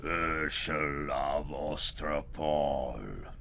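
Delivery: low, slow menacing call; fearsome voice. Acted. Goblin king calling Herschel, fearsome and slow. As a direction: drag out the name like a looming monster. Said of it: "A fearsome voice spoke".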